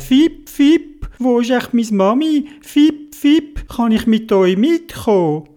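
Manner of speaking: high, peeping little-bird voice; tiny chirping bird. Acted. Lost little bird asks to come along, high plaintive chirping. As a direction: pipe up high, with squeaked cheeps. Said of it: "Und der Vogel hat gesagt".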